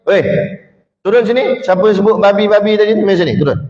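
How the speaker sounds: imitating a student shouting at a friend; retold yelling student. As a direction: call out like an angry boy in the dorm. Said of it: "bila dia jerit dengar".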